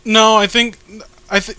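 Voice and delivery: ordinary talk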